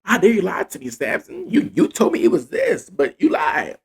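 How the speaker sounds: high-pitched, hammed-up accusation; mock crying complaint. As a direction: imitate a wounded woman pleading and scolding. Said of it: "She kept crying and kept saying".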